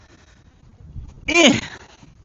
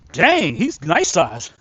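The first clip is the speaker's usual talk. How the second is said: normal talking voice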